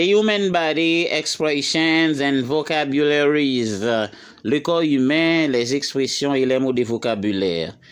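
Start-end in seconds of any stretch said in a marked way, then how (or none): none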